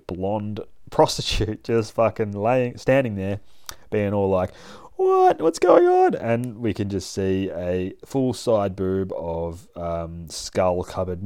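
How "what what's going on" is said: very high, bewildered squeak; confused squeak. Acted. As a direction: blonde prostitute squeaking at the zombie in confusion, high and startled. Blonde prostitute confused, acted quote.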